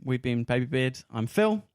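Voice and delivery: ordinary talk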